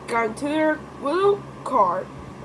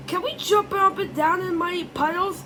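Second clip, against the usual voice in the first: higher, eager piggy question; Peppa asking; 8 semitones higher than usual. Retold or imitated. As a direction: ask it like an excited little pig. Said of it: "asked Peppa".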